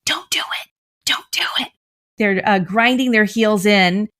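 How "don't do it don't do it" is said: unvoiced urgent whisper, as the voice of anxiety. Anxiety urging the teen to back off, whispered. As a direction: hiss a warning to stop. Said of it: "their anxiety is like".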